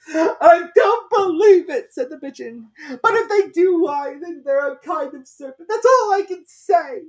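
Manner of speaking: very high, loud, tearful bird voice; accusing pigeon. A narrator's reading. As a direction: insist she's a serpent. Pigeon argues back.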